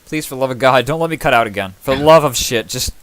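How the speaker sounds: loud desperate plea; beg, voice rising; pleading loudly. Real, not acted. DM begs not to drop out.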